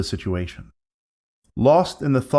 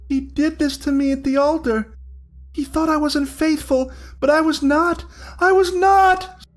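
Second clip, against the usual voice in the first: ghost bride wailing; 14 semitones higher than usual. Acted. Ghost bride protesting her faithfulness, building to a shriek. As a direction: rise from grief into an anguished wail.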